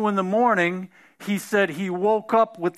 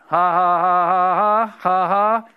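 loud hearty ha ha ha; booming mock laughter; demonstrated laugh; 4 dB louder than usual. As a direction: belly laugh in defiance. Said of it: "He just started laughing".

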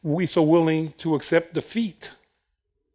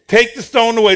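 higher, louder order; loud command; 5 semitones higher, 6 dB louder than usual. Retold or imitated. Preacher repeating Jesus's order, loud. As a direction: call it out with force.

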